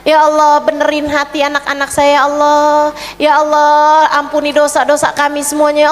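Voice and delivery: ordinary talk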